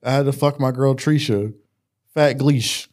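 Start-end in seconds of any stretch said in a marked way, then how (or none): none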